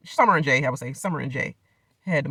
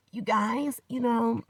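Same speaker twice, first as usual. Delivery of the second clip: imitating Summer; 7 semitones higher, 4 dB quieter, much slower than usual. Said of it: "she sound cheery cancer".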